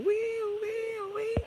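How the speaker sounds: high wailing mock siren; long, high-pitched "wee" siren noise; police siren imitation.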